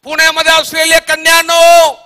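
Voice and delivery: high, sustained shout to the crowd; full-force preacher's cry; calling out. Acted. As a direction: ring it out as a prophetic summons. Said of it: "कन्या लोकांना बघून सांगत आहे".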